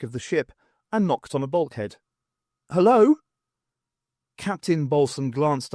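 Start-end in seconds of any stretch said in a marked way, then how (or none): 2.7-3.2 raised, high call into the room. Acted. Bernice calling into the flight deck, raised and high. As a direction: call out to see if anyone's there.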